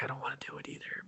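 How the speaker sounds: barely voiced, quick grumble; imitated resigned aside under the breath; reluctant whisper. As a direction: whisper it like a reluctant inner thought. Voices reluctant dad's sighing thought.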